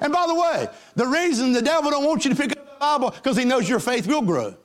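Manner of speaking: high, driving preacher's climax; preaching at full force. Acted. Preacher rousing his congregation, full force. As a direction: build it up for the church.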